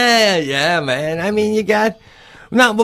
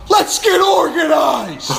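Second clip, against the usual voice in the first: mock pep talk; 10 semitones higher, slower than usual. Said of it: "get in there and be like".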